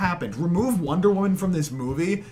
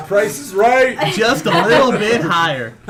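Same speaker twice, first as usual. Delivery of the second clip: laughing; 5 semitones higher, 7 dB louder, slower than usual. Real, not acted. Teasing the rating, laughing.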